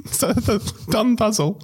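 high giggling through the words; laughing. Real, not acted. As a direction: laugh out the punchline. Laughing at own mnemonic.

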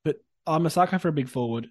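usual speaking voice

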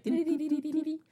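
high, sneaky sing-song tiptoe notes; sneaky tiptoe hum. Pretend. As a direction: hum like a cartoon creature sneaking. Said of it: "sneaky, like".

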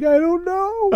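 high, crying whimper; mock crying woman. Woman in the crowd answering through mock tears. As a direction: squeak it out like she's crying. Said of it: "She starts crying".

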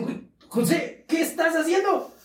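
mock-amazed monks; astonished shout from below. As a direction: call up to him in disbelief. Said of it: "lo ven en el aire y dicen".

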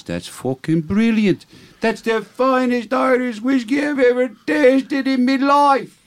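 very high, slow squeal of Irish delight; over-the-top Irish wife, rising to a loud high pitch; horrible Irish accent. Acted. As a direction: crow it out in shrieking stage-Irish. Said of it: "your horrible accent that makes the joke".

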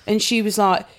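usual speaking voice

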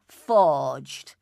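low, loud single word; blunt dismissal. A narrator's reading. Enoch Davis rejecting the stranger's photos, blunt. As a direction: knock it down in a low voice. Curt contradiction of offered proof.

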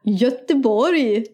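slow, sing-song Gothenburg dialect; Gothenburg accent. Acted. Introducing the next dialect, Gothenburg accent. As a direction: stretch it like a Gothenburger. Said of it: "Okej, nästa då".

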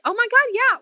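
high-pitched dawning astonishment; sudden realisation. Retold or imitated. Mother realising her therapist is right, astonished. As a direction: replay a startled penny-drop moment.

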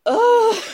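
high, loud "ugh"; disgusted groan. Real, not acted. Disgusted reaction to quoted remark.

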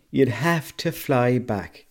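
slow, emphatic repeat. Pretend. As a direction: drag the punchline out at half speed. Repeats joke punchline slowly for emphasis.

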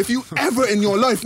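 usual speaking voice

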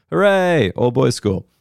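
high-pitched sarcastic hooray; mock cheer. Mock cheer about being written on.

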